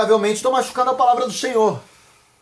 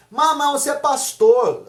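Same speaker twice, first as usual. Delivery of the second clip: higher, drawn-out challenging question; incredulous questioner; 5 semitones higher than usual. Acted. Someone questions the pastor about his marriage, incredulous questioner. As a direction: put it as a puzzled challenge, pitched up.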